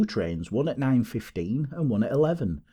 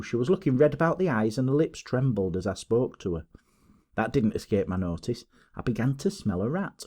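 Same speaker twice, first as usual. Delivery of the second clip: ordinary delivery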